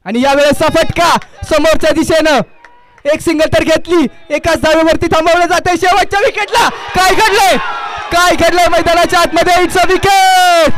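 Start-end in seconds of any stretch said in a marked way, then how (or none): 4.3-10.7 excited shout. Real, not acted. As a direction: yell the twist in the match. Last-wicket run-out call. (उत्साही आरोळी)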